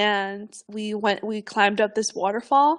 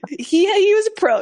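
laughing, high and louder; laughing admiration; 9 semitones higher, 8 dB louder than usual. Real, not acted. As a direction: burst out laughing as you say it. Laughing about the tour guide.

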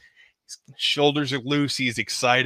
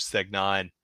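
ordinary talk, nothing marked

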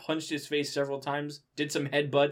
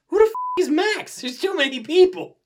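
real shout, much higher and louder; exasperated outburst; 13 dB louder than usual. Real, not acted. Reader yells at the story, fed up and shouting. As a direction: blurt it out, fed up. Reader baffled by new character.

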